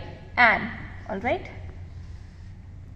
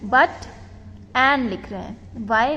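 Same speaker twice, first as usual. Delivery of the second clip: ordinary delivery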